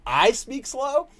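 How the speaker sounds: high, drawn-out incredulous question; mock disbelief. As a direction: stretch it upward in mock disbelief. Retells own disbelieving reply.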